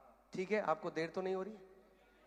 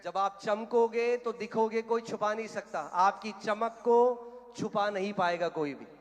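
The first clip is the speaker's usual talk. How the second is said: loud, higher-pitched preacher's proclamation; full-force preaching; 5 semitones higher, 11 dB louder, slower than usual. Real, not acted. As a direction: preach it out to the whole hall.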